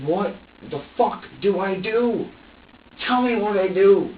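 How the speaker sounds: high, loud, exasperated plea; desperate plea. Retold or imitated. Overwhelmed father pleads with crying baby, desperate. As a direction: plead loudly, at a loss.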